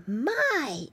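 put-on high piggy-boy gasp of wonder; surprised exclamation. A narrator's reading. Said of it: "exclaimed Flop".

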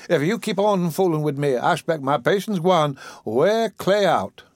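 put-on high, offended old woman's voice; indignant old auntie. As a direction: put the boy in his place. Dicey indignant at being called Missus.

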